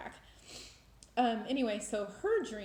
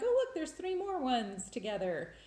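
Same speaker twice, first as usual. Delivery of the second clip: tag excited discovery; 5 semitones higher than usual. Retold or imitated. Retold delighted discovery of more signs.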